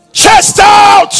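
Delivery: high parade-ground shout; drill commander. Acted. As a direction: order a soldier to straighten up. Drills officer like an army commander.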